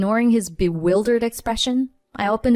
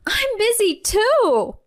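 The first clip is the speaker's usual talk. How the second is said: much higher, slow tearful cry; wife exclaiming through tears at her husband; 14 semitones higher, 4 dB louder, slower than usual. Acted. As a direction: cry it out, voice strained high. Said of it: "I exclaimed through tears".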